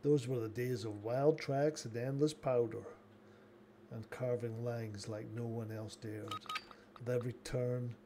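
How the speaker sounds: soft, low storyteller voice; story narration. Acted. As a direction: tell it like an epic tale. Reads an epic life tale.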